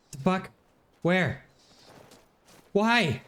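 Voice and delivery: confused, rising high questions; startled. Real, not acted. Sudden attack in game.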